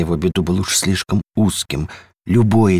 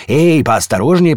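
high, loud storybook warning; cautioning; 7 semitones higher, 5 dB louder than usual. A narrator's reading. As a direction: call out a warning to be careful. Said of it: "предостерёг дом". (предостерегающий окрик)

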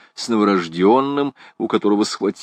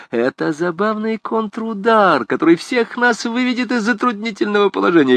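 high-pitched, self-pleased excitement; beaming schemer; 12 semitones higher than usual. Acted. Gringoire lets the priest in on his scheme, gleeful. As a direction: relish your clever plan.

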